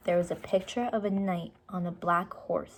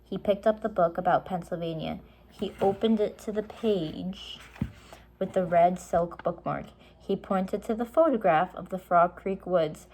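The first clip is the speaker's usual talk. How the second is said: usual speaking voice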